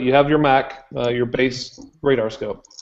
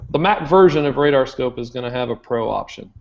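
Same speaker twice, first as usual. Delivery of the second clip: usual speaking voice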